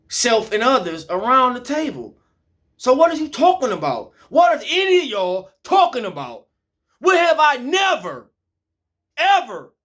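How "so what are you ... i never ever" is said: higher and louder, pressing each question; heated demand. Real, not acted. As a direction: hurl the questions back at them. Climax of angry monologue.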